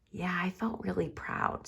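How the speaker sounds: hushed proud confession; whisper. Shown as a demonstration. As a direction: whisper it to the kids. Whispered aside to class.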